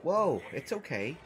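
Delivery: ordinary delivery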